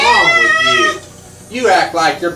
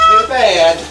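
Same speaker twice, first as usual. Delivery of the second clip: ordinary talk, nothing marked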